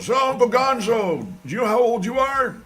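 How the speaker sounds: put-on high, loud young voice asking Uncle Gonzo; a kid pestering his old uncle, high and loud; kid nephew. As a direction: play an eager young relative asking. Imagined nephew asking his age.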